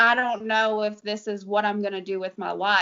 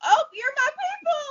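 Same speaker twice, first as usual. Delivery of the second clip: much higher, excited "you're my people"; delighted squeal; 4 dB louder than usual. Retold or imitated. Telling the customer she found her people, excited high squeal. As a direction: squeal it with happy recognition. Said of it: "I was like".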